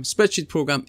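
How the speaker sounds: ordinary talk, nothing marked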